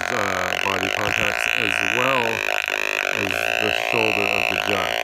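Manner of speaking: loud, boxy radio speaker sound; crackly walkie-talkie loudspeaker voice; through a CB handset. Real, not acted. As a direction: talk into the handset so it blares from the speaker.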